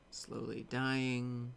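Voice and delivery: drawn out slowly, mock despair; mock dramatic, slow. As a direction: stretch it like a dying man. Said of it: "I'll just keep waiting here".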